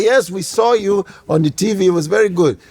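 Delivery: put-on Nigerian accent, formal praise dropping to an order; deadpan mock Nigerian father. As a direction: play an unimpressed Nigerian dad. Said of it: "they get right back to being Nigerian. My father said".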